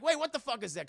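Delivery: imitating a startled character. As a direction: jump at something strange. Voicing Kyle Chandler's alarm.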